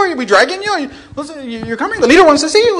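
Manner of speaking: high, loud, urgent order; insistent guards. Retold or imitated. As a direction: call out like guards hauling someone in. Acted guards hauling a man in.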